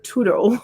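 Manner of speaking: "toodle" for turtle, the r dropped; mispronounced turtle. Retold or imitated. Imitating a Vietnamese mom who can't say turtle, mispronounced as "toodle". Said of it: "she can't say turtle".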